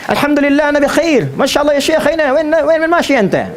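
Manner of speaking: high-pitched, put-on Arabic speaker.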